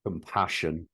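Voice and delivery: a mock Birmingham Compassion; Compassion. in a light Brummie accent. Shown as a demonstration. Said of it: "that was my Brummie accent".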